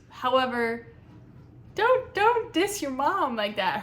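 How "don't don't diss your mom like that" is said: louder, higher, slower scolding; mock scolding. Real, not acted. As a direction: tell her off half-jokingly. Scolding the narrator.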